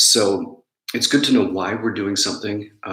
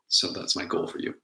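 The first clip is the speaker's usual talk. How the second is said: ordinary talk, nothing marked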